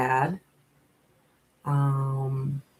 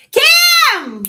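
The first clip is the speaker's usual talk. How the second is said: excited call; 11 dB louder than usual. Real, not acted. Spotting her friend Kim in the chat, high loud shriek.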